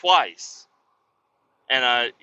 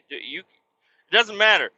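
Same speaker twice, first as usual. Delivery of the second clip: ranting at the guy; 4 semitones higher than usual. Retold or imitated. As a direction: build into an incredulous rant. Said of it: "I'd be like".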